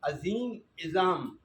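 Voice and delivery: عظیم then عظام, each spelled out slowly; slow teaching demo. Shown as a demonstration. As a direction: drag عظیم عظام to show the plural pattern. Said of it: "عظیم فعیل کی جمع فعال ہے".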